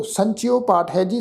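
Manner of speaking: ordinary talk, nothing marked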